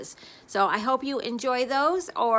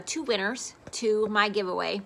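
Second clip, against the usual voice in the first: ordinary delivery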